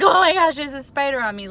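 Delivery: high, loud panic that tails off; freaked-out shriek. Retold or imitated. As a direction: shriek at the spider on you. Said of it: "screaming like a little girl".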